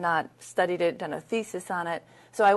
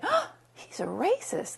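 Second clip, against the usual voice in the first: high-pitched, drawn-out mock gasp of disbelief; shocked realisation; 9 semitones higher, slower than usual. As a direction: act out a scandalised inner voice, slow and high. Retells her shocked inner reaction.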